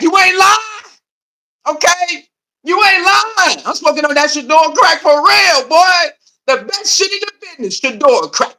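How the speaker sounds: high, hammed-up junkie voice; mock crackhead. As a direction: play the hooked fan, over the top.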